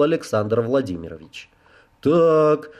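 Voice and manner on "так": reproachful rising question. A narrator's reading. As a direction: chide them mildly. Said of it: "укоризненно начал он".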